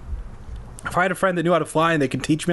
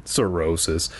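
plain reading voice

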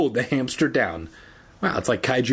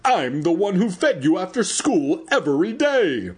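raised, earnest appeal; pleading; 7 semitones higher than usual. Acted. Evan appeals to the giant hamster he fed after school, pleading. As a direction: plead in a higher voice, as if to jog a memory.

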